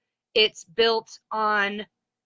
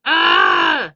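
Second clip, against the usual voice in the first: short loud frustrated shout; exasperated yelp; frustrated yell; 5 semitones lower, 10 dB louder than usual. Real, not acted. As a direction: blurt out an exasperated Ah. Frustrated at losing her thought.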